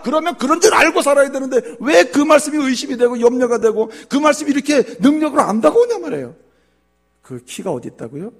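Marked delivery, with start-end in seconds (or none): 0.0-6.4 very high, loud shouted preaching; full force preaching. Acted. Pastor exhorting the church, loud shouting preaching. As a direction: hammer the point home.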